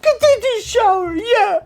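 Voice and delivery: high, teary sobbing voice; choking words out through sobs; ugly crying. Acted. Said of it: "enough of the ugly crying".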